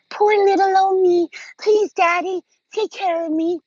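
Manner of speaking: high, put-on little-girl whine mocking her; mocking helpless damsel. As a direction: squeak it out like a helpless girl begging Daddy. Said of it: "I was just sitting there like".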